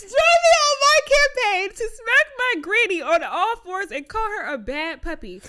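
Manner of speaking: high, loud repeat in disbelief; loud incredulous reread. Shown as a demonstration. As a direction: shout it back, laughing.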